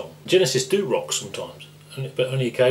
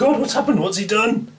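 mock panic; 12 semitones higher, 4 dB louder than usual. Mock panic after Hector strikes.

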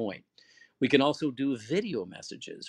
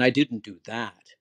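louder I didn't do that with that leaned on; stress on that; 5 dB louder than usual. Shown as a demonstration. Teacher shows stress on the word that, louder. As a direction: press hard on that. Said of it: "that stressor on the word that".

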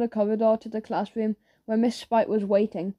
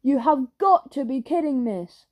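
higher, exasperated protest; protesting disbelief; 3 semitones higher than usual. A narrator's reading. Pupil objects to the teacher's verdict, protesting disbelief.

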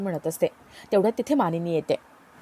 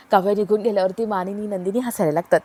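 usual speaking voice

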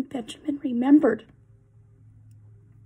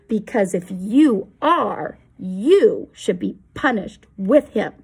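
menacing crowd, rising louder on the questions; hostile threat; 3 semitones lower, 7 dB louder than usual. Acted. The angry crowd threatens Benjamin, loud and menacing. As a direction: threaten the boy.

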